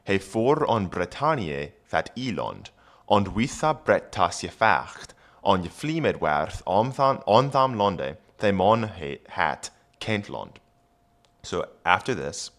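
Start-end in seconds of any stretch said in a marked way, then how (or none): 0.0-10.6 put-on reading Old English aloud; careful Old English reading. Shown as a demonstration. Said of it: "reading Old English text aloud".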